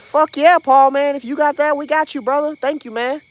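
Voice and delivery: loud, high, hyped shout-out; real excited gratitude, loud and high; excited thanks. Real, not acted. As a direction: cheer the donor by name for the whole chat.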